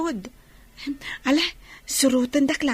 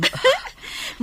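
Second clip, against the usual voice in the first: high, breathy laugh with no voice; 11 semitones higher than usual. Acted. Daughter laughs at the tender reunion.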